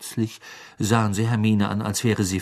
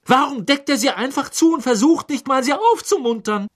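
higher indignant question; upset; 14 semitones higher than usual. A narrator's reading. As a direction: protest on the elf's behalf. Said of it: "sagte Hermine aufgebracht".

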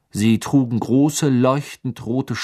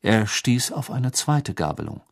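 usual speaking voice

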